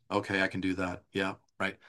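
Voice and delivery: soft, listless compliance without inflection; flat monotone. Shown as a demonstration. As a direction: agree as if collapsed, all on one note.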